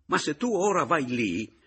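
high, loud, agitated burst; almost angry. A narrator's reading. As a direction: snap at him, exasperated and urgent. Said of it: "scattò Roberto quasi rabbiosamente".

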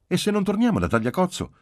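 put-on high, loud child voice; high-pitched child's insistent question; objecting little girl. A narrator's reading. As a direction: challenge Dad with a worried what-if. Child objects to her father.

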